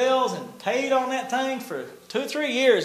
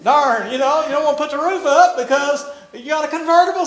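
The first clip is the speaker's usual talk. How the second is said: usual speaking voice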